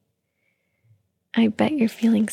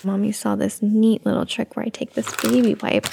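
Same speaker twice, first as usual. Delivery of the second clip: normal talking voice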